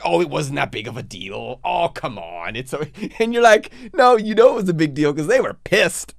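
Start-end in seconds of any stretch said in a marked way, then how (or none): 0.0-2.9 backpedaling. Acted. Waving off an apology, mock backpedaling. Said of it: "they try to backpedal like". 3.9-6.2 high, loud mock protest breaking into laughter; laughing protest. Contradicting someone who downplayed it, laughing protest.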